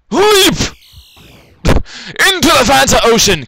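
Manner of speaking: very high, loud, drawn-out cry; battle cry. Real, not acted. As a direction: holler the character into the jump. Shouting character into a jump.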